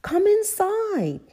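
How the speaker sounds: very high, loud, drawn-out invitation; Granny Pig. A narrator's reading. Granny welcoming her grandchildren in, high lilt. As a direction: usher them in with a singing lilt. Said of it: "answered Granny Pig".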